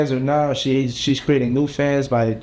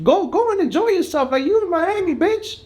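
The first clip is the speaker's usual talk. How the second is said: much higher, excited push to go have fun; excited urging. Real, not acted. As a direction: cheer them on to party, way up in pitch. Urging spring breakers to party.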